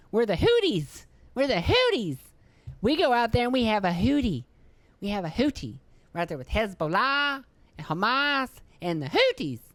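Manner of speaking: high, silly put-on group chant; hammed-up, high-pitched mocking impression; mock Houthi. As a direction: hoot it out in a high cartoonish swoop. Mimics the group with silly name.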